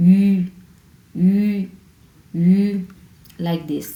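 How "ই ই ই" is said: ই pushed through tensed lips, like French u; slightly hard vowel. Shown as a demonstration. Teacher demonstrating a harder vowel to learners, pressed sound. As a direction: press the lip sides and hold ই firmly. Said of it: "pressure দিয়ে আপনাকে উচ্চারণ করতে হবে".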